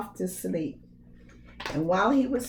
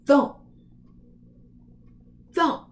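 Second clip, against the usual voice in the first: loud thumps; 5 semitones higher, 6 dB louder than usual. A narrator's reading. Giant footsteps.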